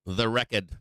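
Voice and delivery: "The Record" in a Boston accent, dropping the r: "Recahd". Shown as a demonstration. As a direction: say "The Record" like a Boston local, no r at the end. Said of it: "you gotta say it with the accent".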